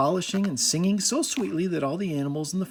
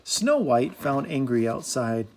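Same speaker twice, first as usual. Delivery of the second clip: usual speaking voice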